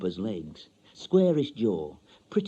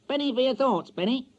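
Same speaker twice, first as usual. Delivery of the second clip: high squawky parrot mimic; 14 semitones higher than usual. The parrot Penny repeating her owner, high parrot voice. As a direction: chirp it back like a talking bird. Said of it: "she says it back to me".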